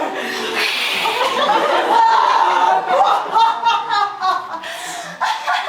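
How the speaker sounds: loud villain laugh; evil laugh. Acted. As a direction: cackle like a triumphant villain. Villain laughs after roar.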